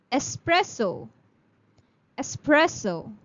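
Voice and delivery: "espresso" said with an S, es-PRES-so, never ex. Shown as a demonstration. Teacher modelling espresso for students. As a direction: open "Espresso" with es-, not ex-. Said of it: "There's no X in the sound".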